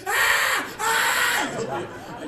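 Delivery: high, loud yelp of alarm; two piercing panicked cries; panicked scream. Acted. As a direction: scream like a lost little child. Acting out panic when lost.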